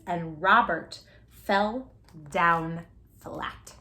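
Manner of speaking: usual speaking voice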